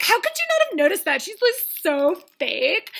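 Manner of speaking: high, loud indignant protest; mock outrage. Contestant berating Peter for not noticing, mock outraged. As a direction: exclaim high, then drag out "fake".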